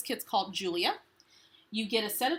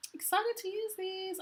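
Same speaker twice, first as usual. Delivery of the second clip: much higher, bright little squeal of excitement; excited; 12 semitones higher than usual. Real, not acted. To viewers about the epoxy dots, high excited squeal.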